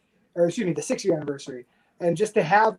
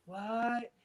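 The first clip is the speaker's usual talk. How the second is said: soft, higher-pitched incredulous "what"; stunned disbelief; 4 semitones higher, 10 dB quieter than usual. Retold or imitated. Wrestler retelling his reaction to the match news, quietly stunned. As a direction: act out a quiet double take.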